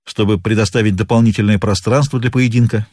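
ordinary delivery

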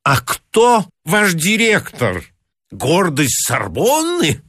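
slow, high sardonic sneer. A narrator's reading. Pedant sneering at the clerk's director, sardonic. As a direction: ask it with biting sarcasm. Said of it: "сардонически осведомился Гарунский".

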